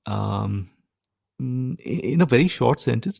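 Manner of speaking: ordinary talk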